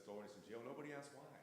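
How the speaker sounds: normal talking voice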